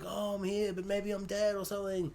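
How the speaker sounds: very soft, hushed voice; barely-there mock ghostly murmur; imitated actor. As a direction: play a faint, maybe-dead man quietly. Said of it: "where he's like".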